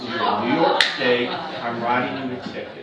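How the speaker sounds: loud, stern cop barking the ticket; a cop's loud, stern ticket warning; retold cop yelling. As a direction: scold him like an angry trooper. Cop scolding the kid.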